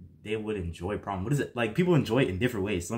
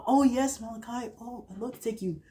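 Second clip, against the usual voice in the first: put-on high, breathy girlish reply; girl's voice; 12 semitones higher, 3 dB quieter than usual. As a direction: play the swooning girl saying yes. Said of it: "And then she'd be like".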